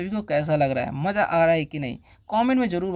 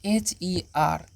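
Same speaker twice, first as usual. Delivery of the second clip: letters spelled slowly one by one; slow letter-by-letter spelling; much slower than usual. Shown as a demonstration. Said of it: "अब देखिए next word क्या है".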